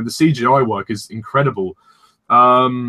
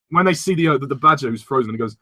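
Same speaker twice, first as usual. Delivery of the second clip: normal talking voice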